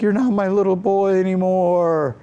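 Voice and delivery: high, wailing imitation of mother; crying mom. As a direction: wail it as a hammed-up weeping mom. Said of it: "she's crying".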